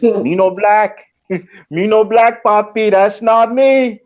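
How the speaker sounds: slow, loud, broken-English mock Spanish accent. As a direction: drag it out in a hammed-up Spanish accent. Mock-accented reply to being called Puerto Rican.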